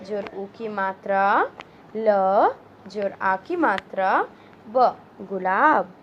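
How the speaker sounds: put-on slow, spelled-out syllables for children; syllable drill. Shown as a demonstration. Teacher sounding out gulab for children, slow and clear. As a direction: build the word sound by sound.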